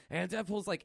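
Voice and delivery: usual speaking voice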